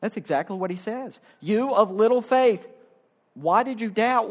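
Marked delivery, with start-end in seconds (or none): 1.4-4.3 a hint of a stern, raised rebuke. Jesus rebukes Peter.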